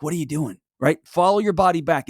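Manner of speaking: ordinary delivery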